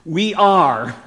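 ARE drawn out and loud; "are" held long and firm; stress on are. Shown as a demonstration. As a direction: lean slowly on "are", stretching it. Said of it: "no way to translate it".

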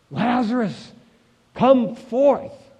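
high, ringing command with a pause between; loud cry. Acted. Preacher voices Jesus calling Lazarus from the tomb, loud cry. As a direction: cry it out to summon the dead. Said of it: "he cried out with a loud voice".

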